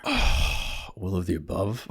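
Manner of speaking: slow, sighing resignation; a resigned, joking exhale; weary sigh. Real, not acted. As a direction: drag it out on a tired sigh. Sighs at career genre question.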